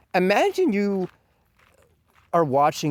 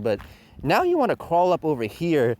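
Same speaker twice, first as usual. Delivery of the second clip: usual speaking voice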